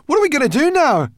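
mounting loud panic; babbled panic. A narrator's reading. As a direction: build to a fast, frantic shriek. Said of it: "babbled Sorrel".

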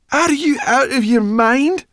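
very high, loud disbelief; shrieked rebuke; outraged scolding. A narrator's reading. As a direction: shout it in exasperation. Sorrel scolds Ben's folly.

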